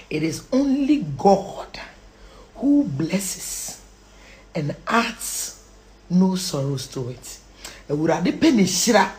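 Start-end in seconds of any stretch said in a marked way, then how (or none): 0.1-7.4 slow recitation with long pauses; measured, phrase-by-phrase emphasis; deliberate verse. Real, not acted. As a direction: set out each phrase separately.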